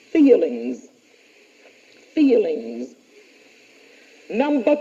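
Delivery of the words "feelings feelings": dictating. Shown as a demonstration. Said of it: "Number two".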